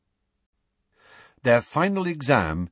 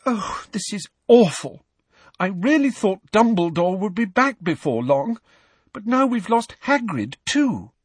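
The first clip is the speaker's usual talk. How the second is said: high-pitched dismay; upset, plaintive girl's voice; tearful; 10 semitones higher than usual. A narrator's reading. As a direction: lament it, close to tears. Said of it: "who looked tearful".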